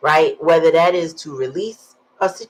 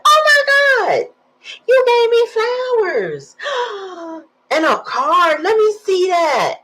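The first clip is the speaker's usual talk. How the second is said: put-on overjoyed girlfriend; 5 dB louder than usual. Girlfriend back in the car, gushing with high excitement. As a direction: shriek with happy tearful surprise.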